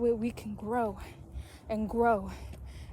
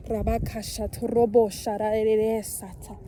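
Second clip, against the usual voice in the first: speaking in tongues; 6 dB louder than usual. Real, not acted. Speaking in tongues while preaching.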